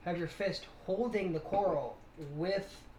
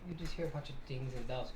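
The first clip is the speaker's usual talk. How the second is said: normal talking voice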